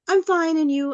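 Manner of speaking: high, perfunctory reply; quick brush-off. Shown as a demonstration. Student replies to a greeting and leaves, quick brush-off. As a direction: answer politely in passing and be gone.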